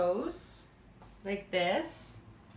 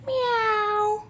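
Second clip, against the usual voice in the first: high, long mewing; cat meow; 8 semitones higher than usual. Pretend. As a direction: mew like a cat at the moon. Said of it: "Mew to the moon".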